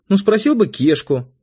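high-pitched pouting daughter; sulky. A narrator's reading. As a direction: pout at your father. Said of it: "надулась дочь".

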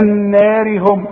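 النار with a thin, light raa, joined on into هم; النار with a light raa, recited slowly. Shown as a demonstration. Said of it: "شوف".

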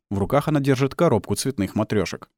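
ordinary delivery, nothing marked

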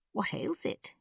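put-on high, soft, thin old man; thin old ruler. A narrator's reading. Old Khan asking about doctor's back, high thin voice. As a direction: inquire in a high, frail voice.

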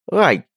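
sudden high loud ouch; yelp of pain. A narrator's reading. Richard yelps when Radgar steps on his foot. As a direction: yelp as if stepped on. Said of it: "вскрикнул он от боли".

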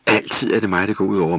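ordinary delivery, nothing marked

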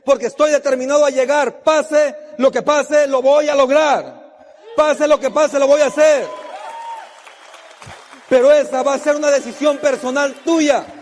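high, loud rally-the-crowd speech; motivational preaching. Real, not acted. Motivational speech at full force. (arenga motivacional a toda voz)

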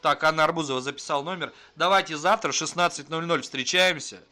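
normal talking voice

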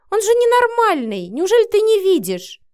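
loud, very high exclamation; exasperated outcry. A narrator's reading. Marina pleads with friend to see sense, exasperated outcry. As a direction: cry out in exasperation, pitch soaring. Friend argues heatedly about the man.

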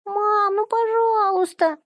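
put-on high whiny child voice; whining little girl. A narrator's reading. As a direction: whine at mom for permission. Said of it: "законючила Иришка".

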